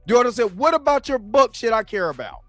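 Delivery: ordinary delivery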